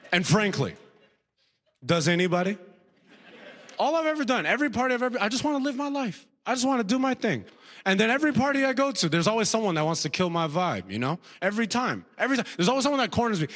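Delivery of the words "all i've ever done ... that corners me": high-pitched, put-upon griping; exasperated complaint. Acted. Comedian complains someone always kills the vibe, high-pitched exasperation. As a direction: protest plaintively, pitched well up.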